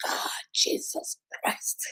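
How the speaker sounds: whispered, slow, exhausted Jesus Christ; mother dreading the kids' noise, whispered; slow weary whisper. Retold or imitated. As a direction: groan it under the breath, drained. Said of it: "I'm like".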